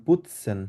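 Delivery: "putzen" with a soft dental t, not a hard t; soft Bengali t sound. Shown as a demonstration. Teacher demonstrates again for a struggling student. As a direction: model "putzen" with a light Bengali ত. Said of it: "আপনি ত উচ্চারণ করবেন".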